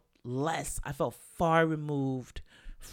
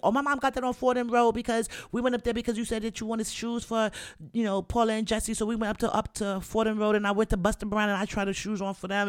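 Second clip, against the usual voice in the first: put-on high, loud, excited kid telling a story; hyped little kid; 7 semitones higher, 5 dB louder than usual. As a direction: rattle it off like an eager child showing off. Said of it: "I'm telling the story hype, like a little kid".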